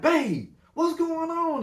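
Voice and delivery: high, loud desperate call. Retold or imitated. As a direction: yell for her down the phone, hurt and confused. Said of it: "My homeboy Marco was like".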